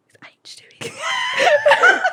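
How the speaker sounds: very high, slow breathless whisper; squeaky hushed thrill; excited whisper. Retold or imitated. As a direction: gasp it with giddy hope. Excited whisper about crush.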